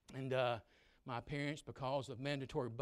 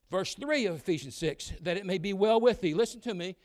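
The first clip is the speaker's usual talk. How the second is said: loud, high preacher's shout; full-force sermon voice; fiery preaching; 6 semitones higher, 12 dB louder than usual. Acted. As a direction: preach it hard to the young people.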